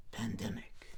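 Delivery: hushed, eerie whisper of the word pandemic; spooky whisper. Pretend. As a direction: breathe it out like a horror teaser. Spooky aside after zombie joke.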